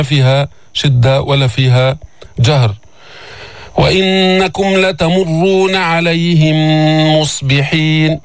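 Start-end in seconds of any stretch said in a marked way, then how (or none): 3.8-8.2 slow chanted verse; Quran recitation. Shown as a demonstration. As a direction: recite evenly with held nasal. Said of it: "teacher recites verse".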